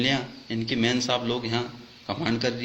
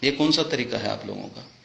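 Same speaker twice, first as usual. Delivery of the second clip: usual speaking voice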